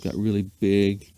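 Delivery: ordinary delivery, nothing marked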